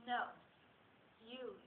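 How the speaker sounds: low, hushed, measured man's line; soft insistence. Acted. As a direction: answer her softly and low. Quotes Bob insisting softly.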